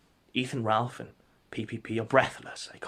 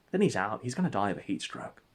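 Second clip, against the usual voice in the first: usual speaking voice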